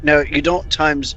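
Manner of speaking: ordinary talk, nothing marked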